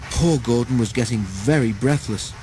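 usual speaking voice

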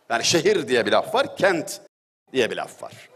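ordinary talk

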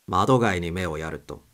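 ordinary delivery

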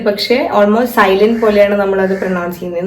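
ordinary talk, nothing marked

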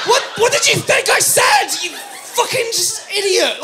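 loud, high indignant shouting; hammed-up furious rant; exasperated outburst. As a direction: blow up at the waitress. Exasperated customer rant at waitress.

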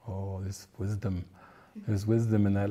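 low, soft mock-reverent musing; hushed realization. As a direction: recall a quiet moment of discovering wisdom. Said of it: "And I'm like".

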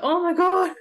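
high, loud thrilled gasp of relief; excited squeal. Retold or imitated. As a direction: squeal it like you finally found help. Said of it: "I was so excited when I stumbled across you".

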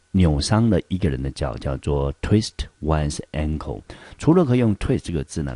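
ordinary talk, nothing marked

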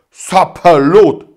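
a drawn-out startled oath; surprised exclamation. A narrator's reading. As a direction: constable amazed at the news, loud. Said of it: "rief der Wachtmeister überrascht".

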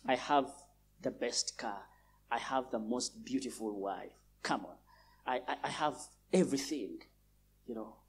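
low, soft, smug bragging; worldly boaster. Retold or imitated. Preacher mimics a worldly man, low and smug. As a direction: brag about car and wife under the breath.

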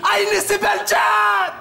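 stretched-out hawker's shout; shouted sales pitch. Acted. Aubergine seller touting to shoppers, shouted hawker's pitch. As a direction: cry up the goods, drawing out the end.

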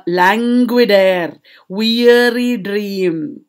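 very slow, drawn-out vowels; languid. Shown as a demonstration. Teacher shows students the lethargic, relaxed words, languid drawl. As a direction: stretch each word lazily. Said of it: "relaxed words".

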